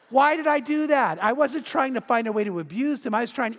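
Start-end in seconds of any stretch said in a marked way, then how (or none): none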